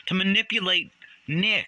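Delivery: high-pitched, exasperated "manipulate Nick"; indignant emphasis. Real, not acted. Outburst, then calmly repeated.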